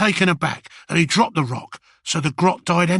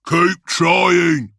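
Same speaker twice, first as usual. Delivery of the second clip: louder, gruff call across the crowd; shouted order; 4 dB louder than usual. Acted. Another orc shouting encouragement, louder. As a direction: bark it over the noise. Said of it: "shouted another orc".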